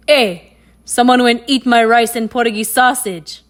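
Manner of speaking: loud, gruff Papa Mongoose growl; deep gruff voice. A narrator's reading. Papa Mongoose complains about his eaten breakfast, loud and gruff. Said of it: "he said in his deep, gruff voice".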